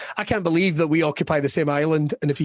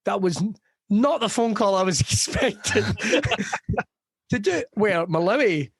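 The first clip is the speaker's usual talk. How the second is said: usual speaking voice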